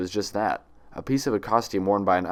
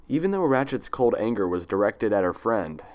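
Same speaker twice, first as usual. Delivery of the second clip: plain reading voice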